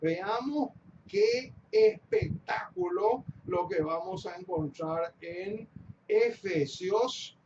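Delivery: ordinary talk, nothing marked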